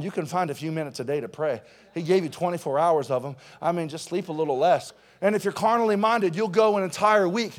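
ordinary talk

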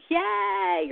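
"yay" stretched out with a rising tune; sing-song "yay" for play; exaggerated play sound. Shown as a demonstration. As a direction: drag the vowel of "yay" upward. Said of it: "play sounds".

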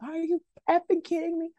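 high-pitched, exasperated disbelief; mock outrage. Parent reacting to toddler's screaming, exasperated mock outrage. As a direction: voice jumps up as a parent imitating losing it. Retold urge to flip her lid.

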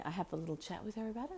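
usual speaking voice

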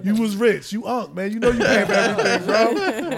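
laughing tease. Real, not acted. Teasing friend through laughter.

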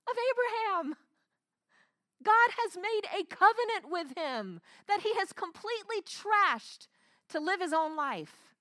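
high-pitched, emphatic preacher's indignation; forceful pulpit exclamation; impassioned preaching. Real, not acted. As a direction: preach it with rising outrage. Preaches with raised voice.

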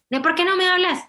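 pregunta aguda de niño que reclama; voz de niño dolido, más aguda; reclamo de niño. Retold or imitated. (el hijo le reclama a mamá que no le habla, agudo y quejoso)

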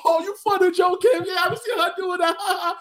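very high, loud mock delight; tickled and squealed, like cheering on an impression; acted amused reaction. Acting amused reaction to imitation.

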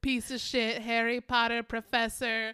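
loud laughing insult chant; gleeful heckling. Retold or imitated. Two friends heckling a TV character, loud laughing insults. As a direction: pile insults on while laughing.

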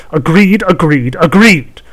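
high, loud, slow triumphant agreed; gleeful witch. Acted. Witch seals the pact.

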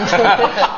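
short laugh rising up; a quick self-mocking giggle. Real, not acted. Host showing off photos with his colleague, laughing.

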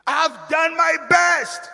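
high, drawn-out complaint; aggrieved protest. Acted. People feeling unappreciated, aggrieved protest. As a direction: stretch it out high, feeling hard done by. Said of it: "many people feeling".

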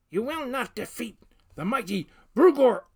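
big orc bellowing its boast; yelling. A narrator's reading. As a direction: roar it out, high and loud. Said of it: "it yelled".

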